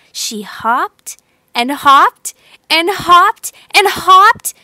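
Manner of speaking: rising, determined hopping; building determination. A narrator's reading. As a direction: drive it up with effort.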